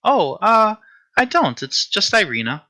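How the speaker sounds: put-on high, hesitant young woman's voice; Irina. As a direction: answer sweetly and a bit unsurely, pitched high. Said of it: "just a soft, sweet voice".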